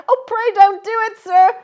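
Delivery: put-on a small boy begging for his life; terrified pleading. A narrator's reading. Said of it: "I pleaded in terror".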